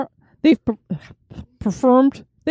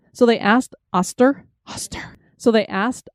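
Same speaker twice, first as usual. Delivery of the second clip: usual speaking voice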